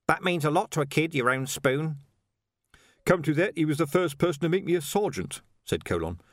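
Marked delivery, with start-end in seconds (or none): 0.1-2.0 higher-pitched, insistent character voice; mildly aggrieved insistence; persistent. A narrator's reading. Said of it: "said Nobby persistently". 3.0-5.4 higher-pitched storybook copper; lightly voiced old-comrade sergeant; reminiscing sergeant. A narrator's reading.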